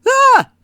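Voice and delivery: short high loud startled scream. A narrator's reading. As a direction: shriek in shock. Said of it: "Tamara screamed".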